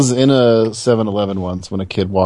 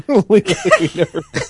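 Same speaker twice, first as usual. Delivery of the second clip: laughing echo, pitched up; 6 semitones higher than usual. Real, not acted. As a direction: repeat it through a laugh. Laughs while echoing co-host's joke.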